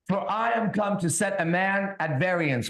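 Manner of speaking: slow, solemn Bible reading; scripture reading. Acted. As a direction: read each word like a sermon. Reads Bible aloud.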